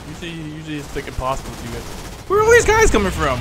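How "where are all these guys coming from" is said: high, loud alarmed outburst. Real, not acted. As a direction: sound swamped by enemies. Swarmed by enemies in game.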